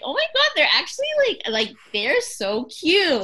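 high-pitched retold squeal, slowing on "so cute"; gushing. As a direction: replay being smitten by a cute couple. Acts out her gushing first reaction.

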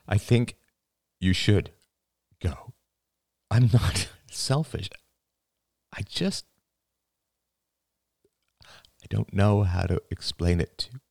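halting, choked-up father voice; tearful, sniffing. A narrator's reading. Father urging daughter to go, tearfully halting. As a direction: struggle through it with broken pauses. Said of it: "he sniffed".

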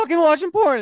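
high-pitched excited hiss to a coworker; excited urgent call. Retold or imitated. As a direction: squeal it out, barely containing the scandal. Telling coworker about porn customer.

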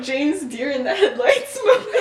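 giggling, pitch climbing at the end; real laughter building on the punchline; laughing. Real, not acted. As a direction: crack up at your own joke. Own joke, laughing.

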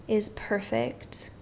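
breathy meditation whisper. Acted. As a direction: drop to a whisper.

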